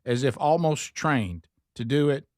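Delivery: normal talking voice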